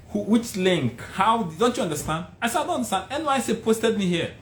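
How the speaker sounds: high-pitched, insistent mock argument; exasperated back-and-forth. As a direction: re-enact a heated interview exchange. Acted quarrel at interview.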